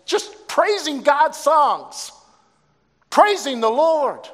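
high, very loud praise cry; exultant. Retold or imitated. Preacher relays dying woman's song list, exultant. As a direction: belt it out with drawn-out fervour.

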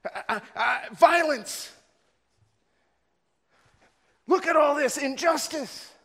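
high, loud desperate plea to God; anguished prophet. Retold or imitated. Preacher voicing Habakkuk crying to the Lord, high and pleading. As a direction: cry out like a prophet at the end of his rope. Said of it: "He says".

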